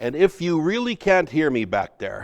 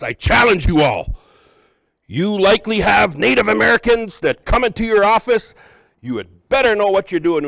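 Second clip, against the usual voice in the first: loud, much higher, staged challenge to the room; raised voice; 8 semitones higher, 9 dB louder than usual. Acted. Speaker to workshop audience, pointing and challenging them loudly. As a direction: call out the audience on purpose, loud and high. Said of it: "raising my voice in that way".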